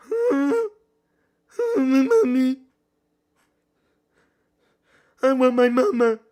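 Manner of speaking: high whiny little-kid voice, pretending to want mommy; mock scared baby. Streamer watching the fight, hamming up a scared baby voice. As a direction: play a frightened toddler, loud and squeaky.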